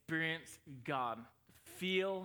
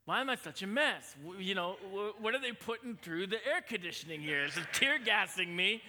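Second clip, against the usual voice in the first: higher and louder, laughing at the silliness; mock bewildered churchgoer; 4 semitones higher, 5 dB louder than usual. Pastor to congregation, mock confused churchgoer laughing. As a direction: play a confused visitor half laughing at themselves. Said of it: "and you're like".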